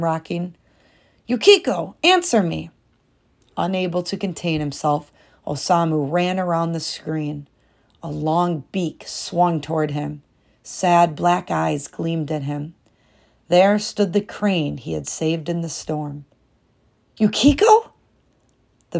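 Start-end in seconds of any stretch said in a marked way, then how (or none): 1.3-2.7 louder, higher demand; demanding call. A narrator's reading. Husband losing patience at the screen, louder. 17.2-17.9 much higher, louder question; stunned disbelief. A narrator's reading. Husband seeing the crane at the loom, stunned. Shocked discovery.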